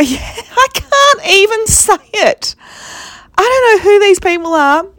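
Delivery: high laugh breaking through the words; can't get it out for laughing; laughing in disbelief. Real, not acted. Telling viewers about the mother and daughter, real disbelieving laughter.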